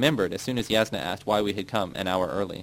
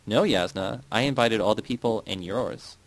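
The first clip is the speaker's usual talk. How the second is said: ordinary delivery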